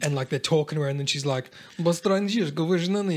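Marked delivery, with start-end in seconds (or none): 1.8-3.2 lightly put-on Russian; an actress faking Russian; movie Russian accent. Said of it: "reviewing her Russian speaking".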